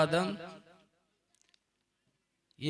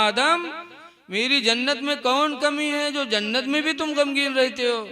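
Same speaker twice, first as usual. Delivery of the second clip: raised, higher voice of God calling Adam; 7 semitones higher than usual. Retold or imitated. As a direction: call out to Adam from on high, much higher. God addresses Adam in story.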